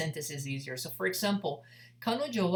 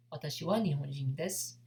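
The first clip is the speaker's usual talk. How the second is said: quick, fluent native pace; natural speed; 2 semitones lower, faster than usual. Shown as a demonstration. Teacher to students learning Japanese, fast natural take. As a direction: rattle it off as a native would.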